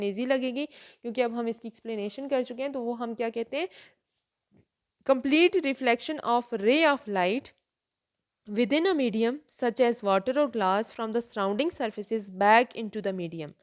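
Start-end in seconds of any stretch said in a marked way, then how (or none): 5.1-13.5 very slow, word by word, for students to write; slow dictation. Shown as a demonstration. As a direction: dictate each word carefully to the class. Teacher dictates definition slowly. (धीरे-धीरे लिखवाना)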